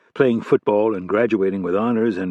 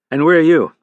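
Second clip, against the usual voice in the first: higher, louder, commanding FBI chief; stern boss voice; 5 semitones higher, 7 dB louder than usual. Acted. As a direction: bark it like an irate boss. Hargrave voice again.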